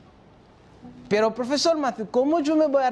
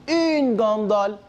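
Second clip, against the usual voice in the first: "Une gondole" spoken slowly and clearly; teacher modelling "Une gondole" slowly; slow model; slower than usual. Shown as a demonstration. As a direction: lay out "Une gondole" slowly for students to copy. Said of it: "Très bien".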